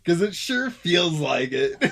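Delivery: loud laughing protest, stretched words; gleeful disbelief. Real, not acted. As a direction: burst out drawn-out, about to crack up. Gleeful at playing a rude deck.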